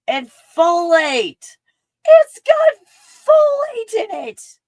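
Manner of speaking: giddy high squeal over folate; giddy excitement. Real, not acted. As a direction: squeal the nutrients in giddy delight. Giddy reading of nutrients.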